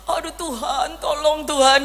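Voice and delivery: teasing singsong imitation of a prayer; mock her plea the way a cheeky child does. Son teases his mother by copying her prayer, mocking. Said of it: "Tapi dengan nada meledek".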